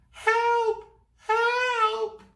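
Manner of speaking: very high loud screams for help; piercing scream. Acted. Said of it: "a piercing scream".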